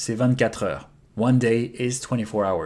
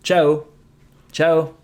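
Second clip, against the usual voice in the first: ciao, ciao with a French accent, a little louder; 3 semitones higher, 3 dB louder than usual. Shown as a demonstration. Teacher showing ciao in a French accent. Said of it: "with a French accent".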